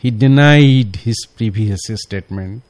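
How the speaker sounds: He denied his previous statement, spelled out slowly; slow, deliberate correct version; very slow dictation. Shown as a demonstration. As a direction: read it word by word for the class. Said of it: "তাহলে সঠিকটা হলো".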